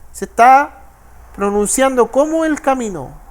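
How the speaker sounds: normal talking voice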